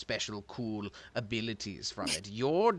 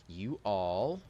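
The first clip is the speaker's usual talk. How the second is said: normal talking voice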